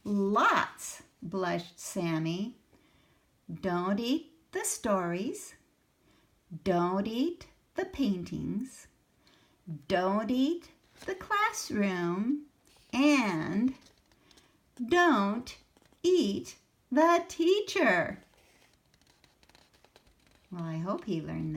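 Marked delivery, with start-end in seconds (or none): 0.0-13.7 put-on reading to children. A narrator's reading. Reads picture book aloud. 14.9-18.2 emphatic. Acted. Sammy's punchline lesson.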